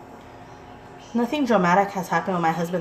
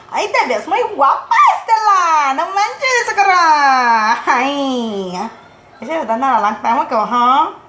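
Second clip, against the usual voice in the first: mock Spanish gushing; 6 semitones higher, 7 dB louder, slower than usual.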